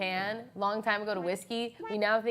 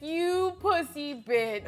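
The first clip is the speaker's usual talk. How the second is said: mocking commenter; 6 semitones higher, much slower than usual. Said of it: "the comments are gonna be like".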